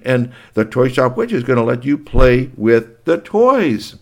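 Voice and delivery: high, loud, eager announcing the party; bubbling over with party news; excited grown-up. Acted.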